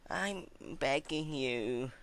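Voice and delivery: slow, desperate plea; urgent begging. Acted. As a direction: beg for your life. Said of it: "Celestia spoke urgently".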